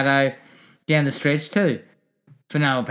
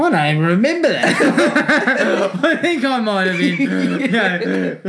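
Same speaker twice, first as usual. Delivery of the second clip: laughing; 3 semitones higher than usual. Real, not acted. Laughing at his own memory gap.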